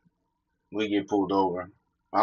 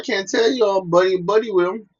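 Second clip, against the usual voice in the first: pitched way up, imitating himself needling a coworker; mocking his own past self; 9 semitones higher than usual. Talking to a coworker about the boss, high mocking voice. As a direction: tease him in a high put-on voice. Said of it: "I was like".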